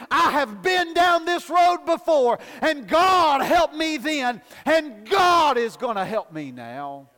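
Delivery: fervent sermon climax; preaching at full force. Acted. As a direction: build the congregation up to a shout.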